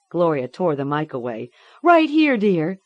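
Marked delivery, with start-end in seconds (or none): 1.8-2.8 high, loud reassurance; anxious mother. A narrator's reading. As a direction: call out to comfort her. Mother answers in alarm.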